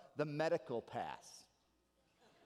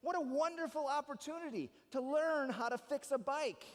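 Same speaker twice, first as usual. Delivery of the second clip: cheerful mom; 8 semitones higher than usual. Retold or imitated. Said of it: "Here's my mom".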